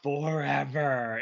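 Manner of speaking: slow, ominous stretched word; ominous drawn-out. Pretend. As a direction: drag it out like a doom verdict. Repeats ominous punchline.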